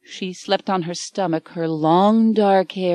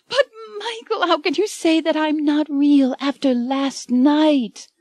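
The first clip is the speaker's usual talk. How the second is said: much higher, breaking tearful voice; crying Anne; 9 semitones higher than usual. A narrator's reading. Anne crying to Michael after he calls her unreal, high and tearful. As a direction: wail it through tears.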